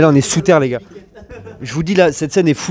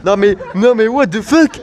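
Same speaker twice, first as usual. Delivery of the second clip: shocked; 6 semitones higher, slower than usual. Real, not acted. Shocked at dead goat.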